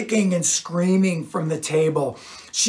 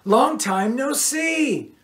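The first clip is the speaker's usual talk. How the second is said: flirty; 8 semitones higher, slower than usual. A narrator's reading. Cheryl flirts with rock star.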